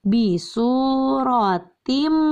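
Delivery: "bisurotim" drawn out slowly, ending in a held m; slow recitation demo. Shown as a demonstration. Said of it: "contoh idghamnya yaitu ini". ("bisurotim" dibaca sangat pelan)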